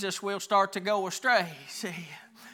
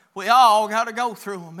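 normal talking voice

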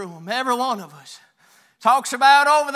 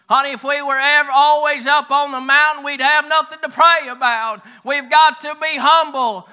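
loud, high, forceful preaching; 5 semitones higher, 8 dB louder than usual. Real, not acted. Preacher urging church to be humble, preaching loudly. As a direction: hammer it home to the congregation.

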